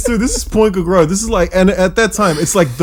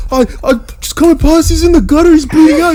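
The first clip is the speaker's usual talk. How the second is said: high, loud, frantic report; imitating a panicking mate, pitched up; panicked friend; 11 semitones higher, 4 dB louder than usual. Mate calling from the roadside, frantic and high. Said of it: "He goes".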